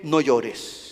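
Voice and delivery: soft, low words of comfort; tender hush. Acted. Jesus softly comforting the widow of Nain, tender. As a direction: gently hush a grieving widow.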